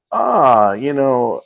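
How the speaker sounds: mildly hammed-up hemming and hawing; drawn-out mock reluctance; imitating reluctant wife. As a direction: imitate someone stalling, unsure. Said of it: "this is what she was like".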